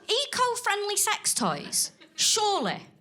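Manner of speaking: incredulous. Retold or imitated. Incredulous inner thought.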